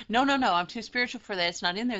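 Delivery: high, loud, fast prissy denial; mock protest. Teacher mimicking a student who claims to be too spiritual, high and fast. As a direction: rattle it off like a self-righteous denier. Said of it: "If you go".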